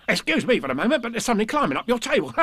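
high, quick tiger voice ending in a laugh; excitable storybook tiger; Tigger. A narrator's reading. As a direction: gabble it high and bouncy. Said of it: "Tigger said".

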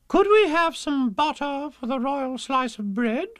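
high, slow, loud royal voice; hammed-up queen, drawn out; queenly. A narrator's reading. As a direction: ask grandly in a high put-on voice. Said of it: "the Queen asked the dairymaid".

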